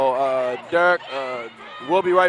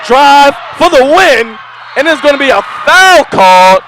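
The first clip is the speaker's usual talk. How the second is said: excited shout; 6 semitones higher, 8 dB louder than usual. Real, not acted. Last-second drive for the win.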